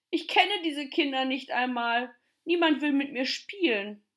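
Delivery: put-on high, upset child's lament; sobbing. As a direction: wail it out, acting tearful. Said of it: "schluchzte Tommy".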